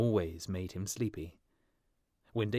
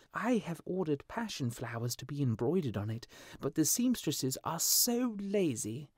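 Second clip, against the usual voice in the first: vain lady; 7 semitones higher than usual. A narrator's reading. Girl on balcony complains to her lover in a high fussy voice. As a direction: play the spoiled girl, pouting and vain.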